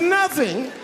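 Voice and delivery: high, rising disbelieving squeak; high mock shock. Husband to wife, high rising shocked squeak. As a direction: act stunned. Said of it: "I said".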